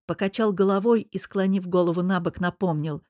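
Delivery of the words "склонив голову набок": ordinary delivery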